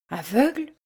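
shocked rising question; stunned. A narrator's reading. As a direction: repeat it in disbelief. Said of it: "répéta la comtesse". (stupéfaite, voix qui monte)

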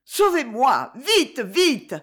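high, pressed cry for help; urgent plea. Acted. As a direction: beg to be saved, urgently. Fleeing danger, pleading.

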